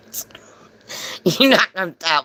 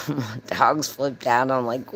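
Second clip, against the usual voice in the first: ordinary delivery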